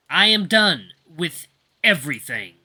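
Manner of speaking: slow, worn-out resignation; pitiful exhale. A narrator's reading. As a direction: let it sink out of you slowly. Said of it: "I exhale pitifully".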